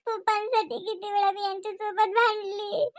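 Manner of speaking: tearful, voice breaking; sobbing as she lists her sacrifices; crying. Acted. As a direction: cry through the words.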